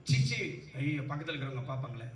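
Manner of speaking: low, soft, hurried aside; acted embarrassed self-talk. Preacher imitating someone worried the people beside them will see, hushed mock fretting. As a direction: fret privately under the voice, mimicking embarrassment. Voices fear of neighbours watching.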